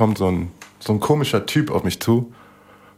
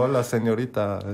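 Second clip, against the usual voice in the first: mock Spanish charmer; 5 dB quieter than usual. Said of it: "Du bist so".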